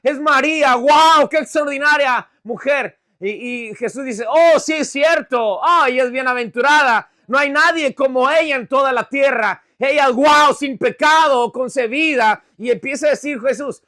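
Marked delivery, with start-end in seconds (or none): 0.0-3.0 loud, higher wow of amazement; acted awestruck outburst; gushing admiration. Acting an emotional admirer of Mary. 4.3-12.4 acted emotional admiration; mock gushing agreement. Mock agreement voiced as Jesus.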